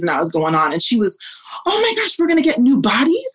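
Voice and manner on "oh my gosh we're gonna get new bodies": high-pitched, wide-eyed excited question; amazed little child. Retold or imitated. Three-year-old daughter hearing about heaven, amazed and high. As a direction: imitate a small child's thrilled disbelief.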